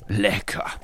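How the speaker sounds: smacking, grateful dog voice; smacking lips. A narrator's reading. Leo thanks the fridge while eating, smacking. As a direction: say it through a mouthful, thankful. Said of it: "schmatzte dieser dankbar".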